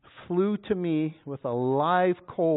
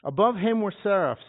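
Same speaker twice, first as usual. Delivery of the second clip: louder and higher, proclaimed; preacher rereads the verse with more force, louder; 5 semitones higher, 6 dB louder than usual. Shown as a demonstration. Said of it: "They probably even did it louder".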